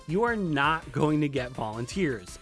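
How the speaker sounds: usual speaking voice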